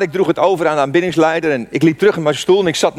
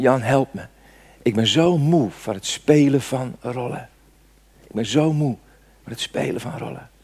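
retold crying plea; 4 semitones lower, 5 dB quieter than usual. Pastor imitating the crying leader's plea, softly tearful. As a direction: voice a broken man gently, almost whispering at the end. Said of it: "Dan begon hij harder te huilen".

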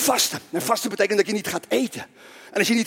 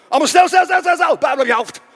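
loud, high rapid-fire orders; urgent hurrying command; 7 semitones higher, 8 dB louder than usual. Pretend. Preacher urging the congregation to put Bibles on heads, loud and urgent. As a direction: hustle everyone along.

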